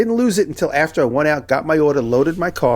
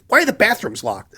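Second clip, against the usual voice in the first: higher-pitched mock indignant demand; mildly hammed-up annoyance, pitched up; angry woman imitation; 7 semitones higher than usual. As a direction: imitate an irritated stranger demanding answers. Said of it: "like an angry chicken".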